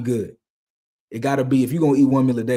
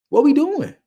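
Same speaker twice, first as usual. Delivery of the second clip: incredulous; 5 dB louder than usual. Real, not acted. Exasperated rhetorical question.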